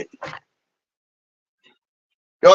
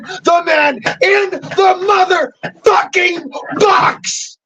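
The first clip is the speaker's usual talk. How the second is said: raging yell, much higher, voice cracking; furious shout; 8 semitones higher than usual. Real, not acted. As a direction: shout the grievance at them, near tears. Raging about being told what to do.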